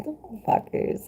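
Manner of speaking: breathy whispered curse; sneaky whispered jab; whispered aside. Real, not acted. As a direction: whisper it under your breath. Muttering aside about haters.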